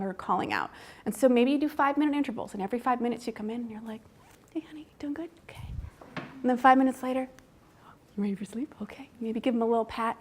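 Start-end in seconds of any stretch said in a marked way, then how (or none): 4.5-5.7 hushed, gentle check-in whisper; soothing whisper. Retold or imitated. Parent whispers check-in to baby. 8.2-9.1 hushed, gentle check-in whisper; soothing whisper. Retold or imitated. Parent whispers check-in to baby.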